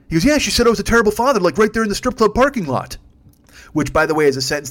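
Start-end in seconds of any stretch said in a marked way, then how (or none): 0.2-3.0 high, loud, fast indignant rant; outraged drunk friend. Retold or imitated. Comedian voicing his drunk friend complaining, loud indignant rant. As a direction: play the wronged drunk buddy.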